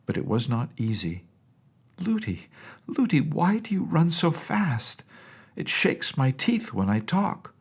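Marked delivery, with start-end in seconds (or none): 2.0-7.5 put-on high, jolted childish question; chattering child. A narrator's reading. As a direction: talk while running hard. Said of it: "kept on chattering as well as she could".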